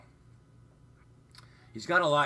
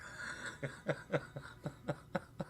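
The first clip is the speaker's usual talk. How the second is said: long soft wheezy laugh; real laughter; 5 semitones higher, 9 dB quieter than usual. Real, not acted. Streamer cracking up over needing a hamper, laughing. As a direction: crack up quietly and keep it going.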